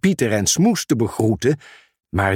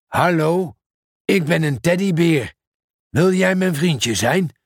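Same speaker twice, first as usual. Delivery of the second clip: talking teddy bear; 4 semitones higher than usual. Acted. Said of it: "zei de teddybeer".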